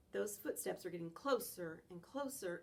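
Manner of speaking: ordinary delivery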